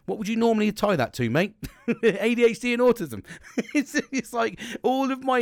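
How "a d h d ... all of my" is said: laughing it out, higher and louder. Real, not acted. Answering his own rhetorical question, laughing loudly.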